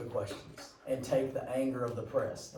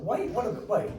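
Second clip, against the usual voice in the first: confused surprise; 7 semitones higher, 3 dB louder than usual. Retold or imitated. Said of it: "I was like".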